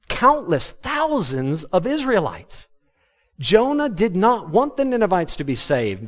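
ordinary talk, nothing marked

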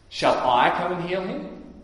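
"I" hit hard in a rising question; stress on I. Acted. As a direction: lean on "I", questioning, pitch climbing at the end. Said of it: "the emphasis is on the "I".

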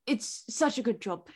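imitating a Brit, slightly rusty British accent. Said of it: "my British accent is crap now".

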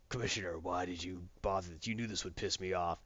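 low, soft vigilante voice; quietly annoyed, pitched well down; Batman. Retold or imitated. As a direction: put on a dark, menacing hero voice. Batman confronting the commissioner.

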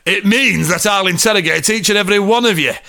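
a touch of theatrical authority; firm inspector. A narrator's reading. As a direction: declare it as a lightly voiced detective. Inspector's speech in story.

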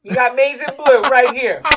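loud, high fan cheer; excited cheer. Real, not acted. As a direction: show off the team colours proudly. Cheering his team's win.

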